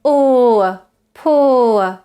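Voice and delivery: long "ur", "poor" gliding from u to schwa; centering diphthong glide. Shown as a demonstration. Teacher showing u position ending with schwa, drawn-out glide. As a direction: stretch "poor" as poo-uh. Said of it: "starts at an u position".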